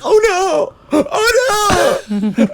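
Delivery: very high mock-panicked squeal. Streamer under a balloon, hammed-up panic. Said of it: "they're like".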